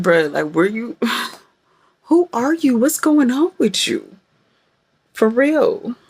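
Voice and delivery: high-pitched mock bewilderment at yourself; theatrical, high-voiced self-interrogation; dramatic disbelief. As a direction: question yourself, exasperated and hammed up. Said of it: "I can be a little dramatic".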